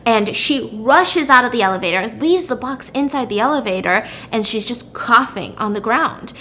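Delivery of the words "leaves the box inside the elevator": usual speaking voice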